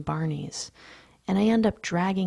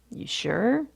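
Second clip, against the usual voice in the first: doubtful question; 6 semitones higher than usual. A narrator's reading. Security guard checks on the two men, doubtful. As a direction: pitch swooping up in doubt.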